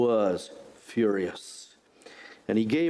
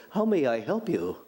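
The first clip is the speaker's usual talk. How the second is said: put-on English accent Siri. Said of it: "a guy with an English accent".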